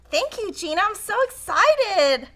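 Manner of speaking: beam your thanks, bursting with joy; excited thanks. Real, not acted. Vlogger thanking coworker Gina on camera, excited gush.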